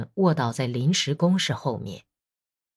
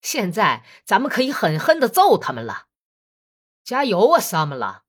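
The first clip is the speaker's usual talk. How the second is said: loud laughing cheer; 3 semitones higher, 4 dB louder than usual. Acted. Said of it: "笑着说".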